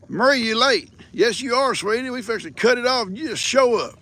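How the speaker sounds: high, loud playful scolding; playful teasing. Real, not acted. Host teasing late viewer Marie, high playful scold. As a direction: tease her for being late.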